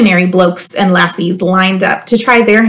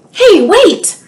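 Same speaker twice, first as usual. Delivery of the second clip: surprised call; 8 semitones higher than usual. A narrator's reading. Sudden interruption of the ending.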